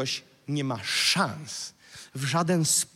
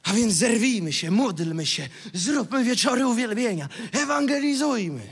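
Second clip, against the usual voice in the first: mock rallying; 5 semitones higher, 3 dB louder than usual. Mimics eager short-lived rallying.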